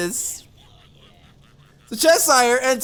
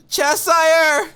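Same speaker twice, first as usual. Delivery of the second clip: exasperated call; 6 semitones higher, 4 dB louder than usual. Real, not acted. Calling out the player.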